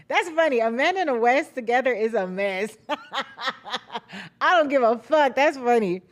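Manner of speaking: cracking up at her own line; laughing. Real, not acted. Vlogger laughing at her own Wes and Amanda joke, real laughter.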